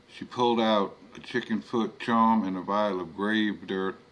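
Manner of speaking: usual speaking voice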